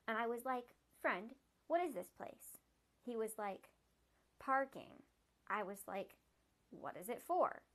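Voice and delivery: steady read-aloud storytelling voice; story narration. A narrator's reading. Reader telling the fox's story, even narration. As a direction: read the fox's tale plainly to listeners.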